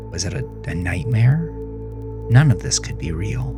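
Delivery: usual speaking voice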